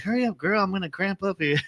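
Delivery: high-pitched put-on voice; imitated impatient complaint. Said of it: "then he's all like".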